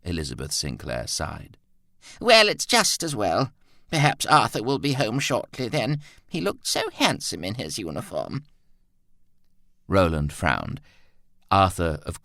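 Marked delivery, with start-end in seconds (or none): 2.2-8.4 put-on high, airy voice of a wistful old lady; lost in fond memories of her son; fond elderly mother. A narrator's reading. Mother dreamily telling Roland of Arthur, high and wistful.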